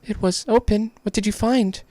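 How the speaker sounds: very high, questioning character voice; eager game character. Acted. Listener presses the explorer for the find, high eager voice. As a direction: pitch it way up and ask eagerly.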